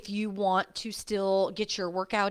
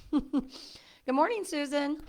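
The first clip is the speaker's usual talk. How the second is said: sing-song greeting; 7 semitones higher, much slower than usual. Real, not acted. Drawn-out playful greeting.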